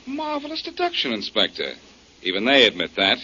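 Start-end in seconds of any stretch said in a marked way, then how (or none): 0.1-1.7 high mock-admiring sneer; sarcastic praise. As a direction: tease him with fake praise. Mocking the inspector.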